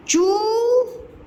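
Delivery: चूहिया broken into long, high syllables; चूहिया very slow, every vowel overstretched; wrong long vowels. Shown as a demonstration. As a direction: drag out चूहिया as "chooo... heee... yaa". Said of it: "ऐसा तो नहीं बोलते हैं इसको".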